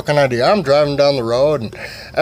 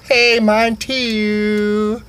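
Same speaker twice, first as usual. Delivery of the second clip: very slow, stretched put-on voice; hammed-up dreamy general; mock dreamy drawl; 7 semitones higher, much slower than usual. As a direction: stretch it out, lovestruck. General very drawn out.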